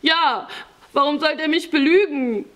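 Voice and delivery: high, plaintive youngster's voice; naive tearful boy. A narrator's reading. Boy defends trusting his cousin, plaintive whining. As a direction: protest like a wounded child.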